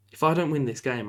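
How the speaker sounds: ordinary talk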